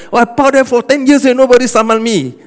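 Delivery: mock stubborn driver. Pastor mimics a driver caught on a yellow line, mildly indignant. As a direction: brush it off like a driver who thinks he's above the rules.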